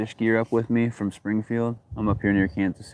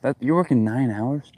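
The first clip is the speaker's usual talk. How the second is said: normal talking voice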